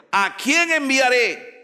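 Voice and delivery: high, loud and slow. Acted. God calls out for a messenger, loud and slow. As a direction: ring it out like a summons from heaven.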